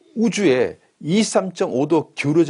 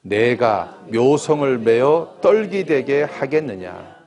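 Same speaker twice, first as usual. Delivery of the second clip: scripture reading; 5 dB louder, much slower than usual. Acted.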